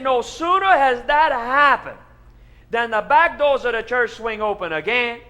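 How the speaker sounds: loud, high, building preacher excitement; excited tall tale. Acted. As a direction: tell it big and breathless. Pastor continues the tall tale.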